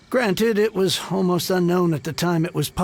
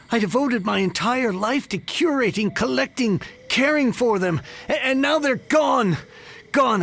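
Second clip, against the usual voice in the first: rising anguish; 7 semitones higher than usual. Acted. Collector mourning his pawned books, building anguish. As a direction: build from lament to an anguished cry.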